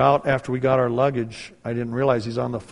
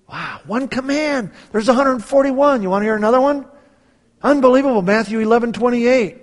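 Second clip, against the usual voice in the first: high, loud pulpit wonder; preacher thrilled, rising in force; excited preaching; 10 semitones higher, 8 dB louder than usual. Acted. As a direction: marvel at it to the crowd.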